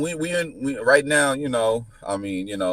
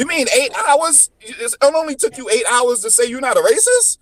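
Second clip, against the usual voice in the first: very high disbelieving question; mock the claim, voice shooting up; incredulous scoff; 14 semitones higher than usual. Speaker ridiculing a cop's eight-hour racism test, incredulous.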